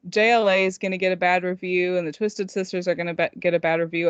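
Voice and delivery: ordinary talk, nothing marked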